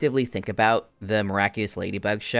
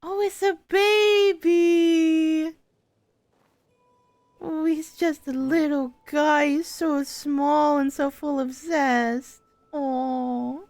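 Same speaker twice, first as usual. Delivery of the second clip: high, slow gushing over a tiny chick; 4 dB louder, much slower than usual. Real, not acted. Cooing over a tiny baby bird.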